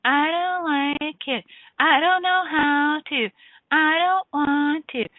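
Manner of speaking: put-on high, slow, whiny kid complaining; nasal-free pouty child whine, drawn out and high; whining child. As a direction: drag every word out like a sulky child. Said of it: "the complaining, whining child would be like".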